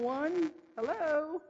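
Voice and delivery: high, teasing "anybody there?" call; mock calling out. Preacher teasing the congregation as hard of hearing, mock calling out.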